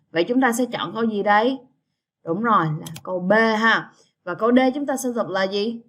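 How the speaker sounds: ordinary talk, nothing marked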